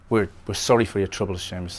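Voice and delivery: a fast, light attempt at a Northern Irish accent; old man offering condolences in a hint of Northern Irish. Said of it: "That's my attempt at a Northern Irish accent".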